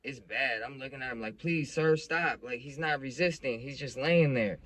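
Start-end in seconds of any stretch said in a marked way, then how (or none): 1.4-2.4 louder, higher begging, acted out; urgent plea. Son begs cop off his dad, pleading. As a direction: re-enact a kid pleading with an officer.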